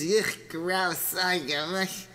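acted revulsion, recoiling from a stink; disgusted. Wife finds smelly shoes in closet, disgusted. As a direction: play up the disgust.

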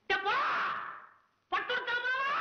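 long, very high-pitched calling out; shouting. Acted. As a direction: yell it out into the distance. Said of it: "கத்தி கத்தி குரலும் போயிட போகுது". (உச்சக்குரலில் கத்தல்)

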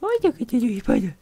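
very high squeak of alarm; high falsetto gasp at the spider; mock fright. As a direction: squeal at the spider like it's a monster. Spotting a spider in game.

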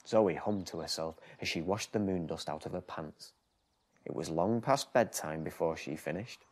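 put-on lively storybook reading for small children; bedtime story narration. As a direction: read it like a bedtime picture book.

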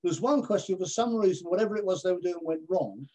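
ordinary talk

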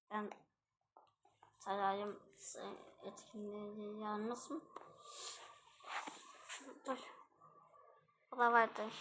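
ordinary talk, nothing marked

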